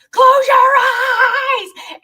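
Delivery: high, slow, loud screamed order on a roller ride; retold scream. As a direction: shriek it out high and drawn out. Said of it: "My mom's screaming".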